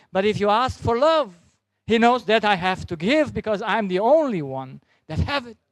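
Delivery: high, loud, building sermon climax; preaching at full force. Acted. Preacher urging the congregation on love, loud fervent preaching. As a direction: drive it home to the congregation.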